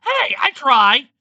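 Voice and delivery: high, loud, drawn-out protest; indignant shout. A narrator's reading. Monster defending his terrible accent, indignant shout. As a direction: yell back as if stung by the insult. Stung retort to accent insult.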